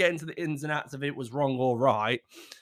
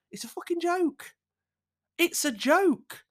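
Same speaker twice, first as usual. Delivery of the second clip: exasperated; 12 semitones higher, slower than usual. Real, not acted. Exasperated insistence.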